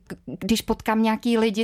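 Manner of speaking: ordinary talk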